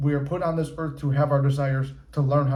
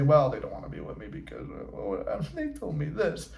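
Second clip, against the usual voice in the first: mocking a self-pitying excuse; 5 dB quieter than usual. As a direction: parody someone moping over their ex's words. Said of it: "I'm not making fun of everyone".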